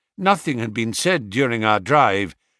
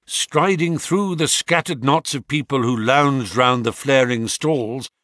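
plain reading voice

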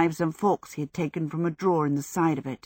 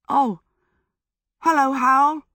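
helpless; 8 semitones higher, 6 dB louder than usual. Acted.

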